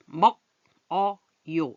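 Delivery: 먹어요 said slowly as meo-geo-yo, ㄱ carried over; linked pronunciation. Shown as a demonstration. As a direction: stretch 먹어요 so the ㄱ links to 어. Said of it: "이건 어떻다고요". (먹어요를 머거요로 연음해서 천천히)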